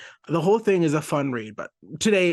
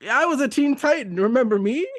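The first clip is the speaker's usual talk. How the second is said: Bunker's exasperated plea; 9 semitones higher, 5 dB louder than usual. Retold or imitated. Bunker reminds the crowd.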